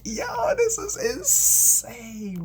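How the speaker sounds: high, loud, drawn-out exclamation; amazed outburst. Retold or imitated. Caller after praying and hanging up, high amazed shout. Retells own amazed reaction.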